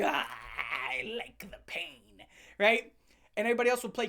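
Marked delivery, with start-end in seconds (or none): 0.0-2.3 much lower and much softer, creepy; low soft creepy murmur; creepy kid. Retold or imitated. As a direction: savor the pain strangely. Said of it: "covered in dirt like".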